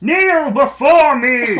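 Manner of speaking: booming, slow mock evil ruler; evil emperor command. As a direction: roar it like a tyrant on his throne. Plays iron-fisted overlord.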